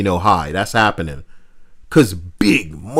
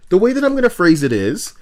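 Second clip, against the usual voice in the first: normal talking voice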